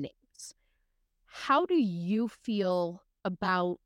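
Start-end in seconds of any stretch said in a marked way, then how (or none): 0.0-0.5 soft whispered admission. Real, not acted. Host confiding to co-host in a whisper. Emotions podcast, sudden whisper.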